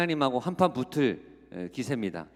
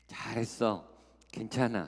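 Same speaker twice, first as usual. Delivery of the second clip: soft comfort; 4 semitones lower, 5 dB quieter than usual. Acted. Comforting words voiced as example.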